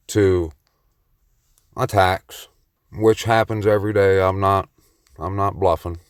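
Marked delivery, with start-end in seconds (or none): none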